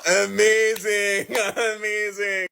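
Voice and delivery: slow, drawn-out sarcasm; deadpan amazing; sarcastic. Retold or imitated. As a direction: stretch the word in mock delight. Sarcastic reaction.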